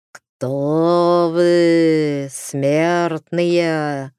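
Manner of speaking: eerie otherworldly howl; howling ghost voice. Acted. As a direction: moan it like the wind. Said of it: "провыл вдруг ветер".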